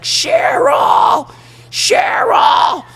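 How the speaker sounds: very high, drawn-out full-volume yell; screaming. Retold or imitated. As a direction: scream it out like a man hunting for someone. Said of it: "This dude is just screaming".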